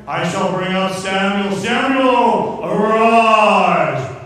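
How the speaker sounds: loud incantation building to a shout; theatrical summoning. Acted. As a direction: call up the dead in a hammed-up cry. Medium theatrically summoning the dead.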